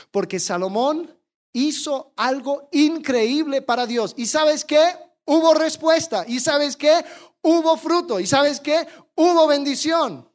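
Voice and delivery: rising, loud pulpit build, each answer hammered home; preaching at full force. Acted. Pastor to congregation about Solomon's fruit, loud preaching build.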